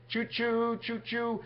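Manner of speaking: high-pitched choo-choo; train whistle imitation. Speaker playing a train after naming the tracks, high choo-choo.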